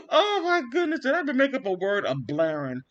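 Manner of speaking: high, loud, fast and laughing at her own joke; real laughter breaking through. Real, not acted. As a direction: crack up over the new word. Laughing at own made-up word.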